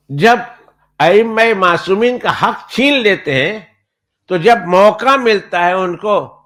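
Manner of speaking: high, loud, indignant claim; mimicking the opponent. Preacher mimicking his Shia opponent, high and indignant. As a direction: mimic the Shia debater's proud protest.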